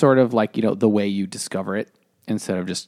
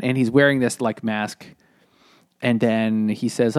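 ordinary talk

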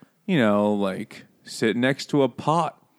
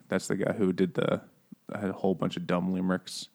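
normal talking voice

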